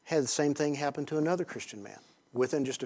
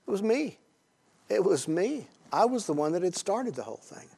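higher, louder admission of guilt; emphatic confession; 7 semitones higher, 3 dB louder than usual. Real, not acted. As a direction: own the blame with force.

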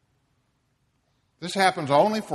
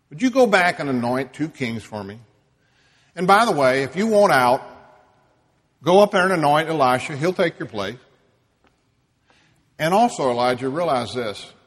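loud, urgent commands; firm charge; 2 semitones higher, 9 dB louder, faster than usual. Acted. God sending Elijah off, loud and commanding. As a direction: push him back to work with force.

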